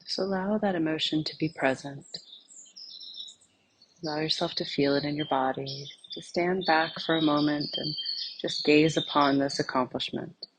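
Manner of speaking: soft, unhurried meditation voice with long pauses; guided meditation. Acted. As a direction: gently lead them inward, phrase by phrase, leaving silence between. Leads a guided meditation exercise.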